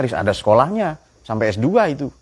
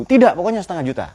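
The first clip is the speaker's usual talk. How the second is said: stubborn insistence; 8 semitones higher, faster than usual. Retold or imitated. Stubborn groom insists on dowry.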